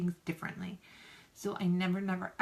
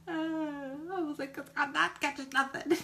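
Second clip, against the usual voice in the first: laughing; 8 semitones higher, faster than usual. Retold or imitated. Quoting herself, breaks into laughter.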